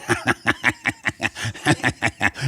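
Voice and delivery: long staccato sidekick laugh; imitated giggle. Sidekick at anything she did, long imitated giggling. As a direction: keep up a steady mimicked chuckle. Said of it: "he was like this".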